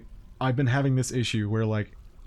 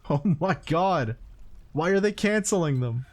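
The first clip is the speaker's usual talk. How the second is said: ordinary talk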